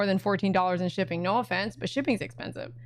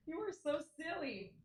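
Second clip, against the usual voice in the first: much softer, higher murmur to the pet; soft coo; 5 semitones higher, 16 dB quieter than usual. Real, not acted. Owner cooing softly at her silly dog.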